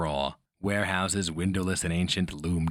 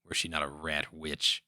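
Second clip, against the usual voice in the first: ordinary delivery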